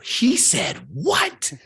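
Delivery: slow, high-pitched imitation of an incredulous mother; outraged mom. Mom hearing what the coach told her son, outraged. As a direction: draw it out, shooting up in disbelief.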